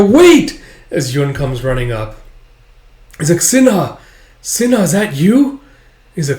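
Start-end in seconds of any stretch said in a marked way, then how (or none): 0.0-0.6 very high, loud plea to halt; urgent shout. Acted. Yun runs up to stop archers, urgent shout. 3.4-5.6 high, raised voice; anxious calling out. Acted. Yun recognizes transformed friend Sinha, anxious calling.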